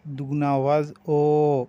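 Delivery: ओ drawn out double, slowly; stretched o. Shown as a demonstration. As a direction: hold the ओ long. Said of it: "दूसरे वाले को हम खींचेंगे". (ओ दुगना खींचकर)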